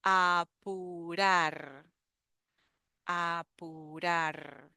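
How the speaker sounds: apurar stretched out, a-pu-rar with a clear U in the middle; teacher drawing out apurar; very slow model word. Shown as a demonstration. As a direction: drag out apurar syllable by syllable. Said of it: "So we have the U in the middle".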